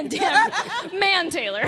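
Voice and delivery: high, loud laughing through the words. Real, not acted. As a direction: giggle out the name. Laughing mishearing of name.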